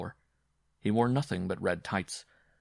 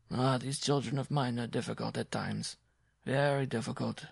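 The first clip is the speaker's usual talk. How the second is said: put-on higher-pitched musing old papa figure; muttering; 4 semitones higher than usual. A narrator's reading. Papa Copo watching his performers leave, muttering. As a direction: sigh over them to yourself. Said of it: "he muttered".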